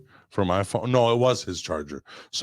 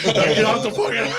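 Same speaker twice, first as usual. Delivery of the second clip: laughing yell; faster than usual. Retold or imitated. Telling friends about fleeing the hot tub, laughing high shout.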